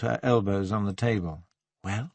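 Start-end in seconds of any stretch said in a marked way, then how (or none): 1.8-2.1 whispered prompt. A narrator's reading. Woman leans forward to press him for an answer, whispering. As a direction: ask it in a near whisper. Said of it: "she whispered".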